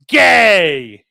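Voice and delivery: loud taunting yell; taunting shout. Retold or imitated. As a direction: bark the word like a playground taunt. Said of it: "He's like".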